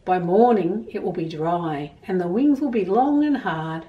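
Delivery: plain reading voice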